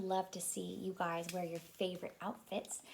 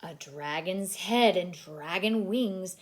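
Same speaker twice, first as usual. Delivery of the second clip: plain reading voice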